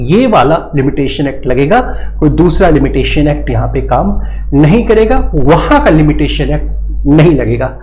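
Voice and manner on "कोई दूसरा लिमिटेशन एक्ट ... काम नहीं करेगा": usual speaking voice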